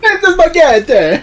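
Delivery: very high, loud cartoon Italian; Italian accent. Friend joking about spaghetti, mock Italian accent. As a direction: ham up a squeaky Italian accent. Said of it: "occasionally Italian if I eat enough spaghetti".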